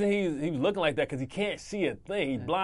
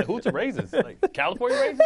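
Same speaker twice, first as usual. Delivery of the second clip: laughing mockery; 11 semitones higher, 4 dB louder than usual. Real, not acted. Mocking the coach's slip.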